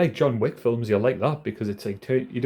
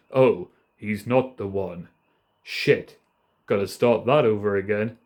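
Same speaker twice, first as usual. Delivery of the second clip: slow, slightly lowered, deliberate figure voice; terrible Morpheus impression; 3 semitones lower, slower than usual. Film fan imagining the mentor's letdown, badly imitated slow voice. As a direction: intone it slowly, like a bad impersonation. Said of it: "Sorry, that was a terrible impression".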